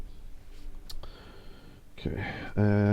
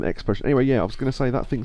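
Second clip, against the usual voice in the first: ordinary talk, nothing marked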